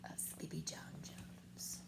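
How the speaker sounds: hushed storybook ending; soft closing whisper. A narrator's reading.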